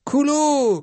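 high, loud chanting cry; wild cry. Acted. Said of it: "cried Queequeg".

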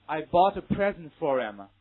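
"for him" joined like forum, whole sentence slow and loud; linked reading. Shown as a demonstration. Teacher giving an example sentence to repeat, slow linked reading. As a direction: model "I bought a present for him" slowly, dropping the h. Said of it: "read after me".

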